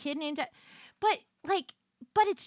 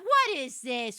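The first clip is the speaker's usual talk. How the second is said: ordinary talk, nothing marked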